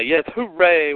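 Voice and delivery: normal talking voice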